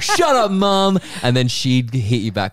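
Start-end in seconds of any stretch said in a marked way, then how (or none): 0.0-1.0 loud, high mock yell at mum; bratty child to mum. Kid showing off to a friend, bratty mock yell at mum. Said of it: "you'd be like".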